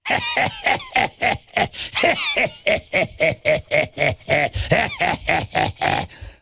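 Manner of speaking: mock laugh. Mocking the tyranny.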